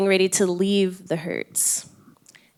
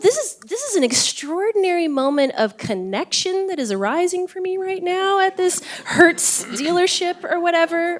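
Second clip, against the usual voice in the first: much higher and louder, marvelling; as if struck by a wonderful surprise; excited inner thought; 11 semitones higher, 4 dB louder than usual. Retold or imitated. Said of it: "I'm thinking to myself".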